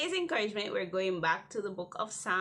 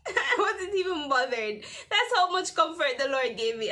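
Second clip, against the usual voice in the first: laughing aside; 5 semitones higher, 5 dB louder than usual. Real, not acted. Laughing at own calm.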